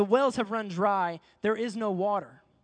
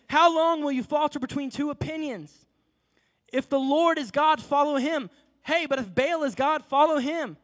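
higher, louder fiery challenge; rallying cry; 4 semitones higher, 4 dB louder than usual. Retold or imitated. Elijah challenging the people on Mount Carmel, fiery and loud. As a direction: fire up the crowd like a general.

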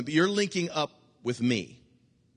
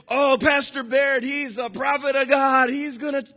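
gushing church member; 11 semitones higher, 6 dB louder than usual. Retold or imitated. Pastor mimics a fawning small-group member, gushing high. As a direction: fawn over the pastor like a new fan.

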